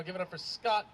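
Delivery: rousing MC introduction. Real, not acted. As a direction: hype the crowd for the next comic. MC calls on crowd to applaud.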